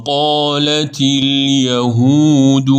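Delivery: slow, chanted tajweed recitation; Quran recitation. Shown as a demonstration. Teacher reciting the verse for students, slow chant. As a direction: recite the verse slowly and melodically.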